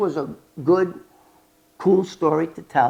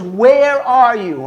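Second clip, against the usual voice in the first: slow, loud, higher demand; demanding agent; 7 semitones higher, 10 dB louder, much slower than usual. Retold or imitated. Agent demanding to know where the informant is, slow and loud. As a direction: drag out the question hard.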